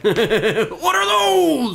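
high, drawn-out loud shout; meme yell. Pretend.

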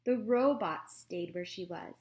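ordinary delivery

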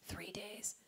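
hushed, barely voiced whisper; whispered repeat. Real, not acted. Comic repeats how long the car lasted, whispering. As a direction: whisper it for emphasis.

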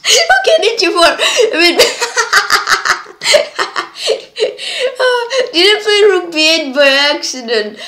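high, loud laughing disbelief. Real, not acted. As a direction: laugh through the words. Laughing at accidental move.

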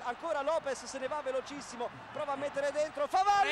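building to a loud goal shout; rising excitement, then yell the name; breathless italian goal commentary. Real, not acted.

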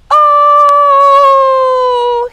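long, high, loud wail; crying out. A narrator's reading. Mouse falling into a hole, crying out. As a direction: cry out loudly on one held high note. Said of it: "he cried".

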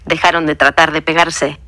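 usual speaking voice

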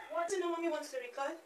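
high, soft motherese. Real, not acted. Coaxing the woken baby.

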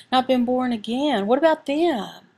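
normal talking voice

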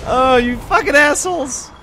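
loud high furious curse; angry yell. Real, not acted. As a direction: yell it at the enemies. Enemies ambush him again.

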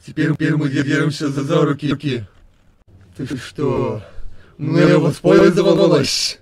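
loud, slow accusing yell; angry accusation. Acted. Error Sans accuses Blitz of using him, loud angry yell. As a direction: rage at being tricked.

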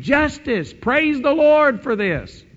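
high, loud, slow exultant praise; praising saints. Pretend. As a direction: shout it out in triumph. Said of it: "praising God and saying".

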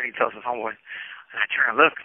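ordinary talk, nothing marked

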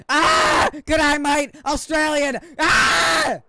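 shrieking high and loud; full-volume shriek, exaggerated bit; meme screaming. Streamer shrieks the Aussie meme for viewers.

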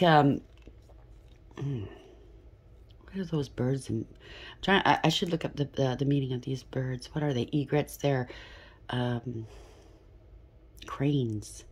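ordinary delivery

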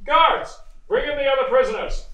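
loud, high command across the courtroom; calling the guards. Acted. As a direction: bark the order so the guards hear. Judge calls guards into court.

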